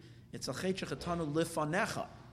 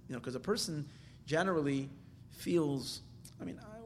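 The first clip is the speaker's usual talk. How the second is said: usual speaking voice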